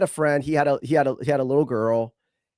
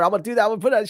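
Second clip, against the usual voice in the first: playful teasing; 7 semitones higher, much faster than usual. Retold or imitated. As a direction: tease the toddler like a mock magic threat. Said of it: "So I was messing with her".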